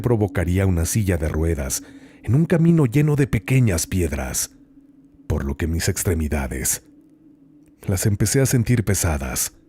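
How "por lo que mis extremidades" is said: ordinary delivery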